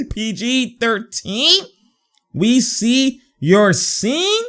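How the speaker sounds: high, slow, stretched hype call; drawn-out catchphrase. Real, not acted. Hyping the player after a basket, high drawn-out catchphrase. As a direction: stretch each word out high, lifting at the end.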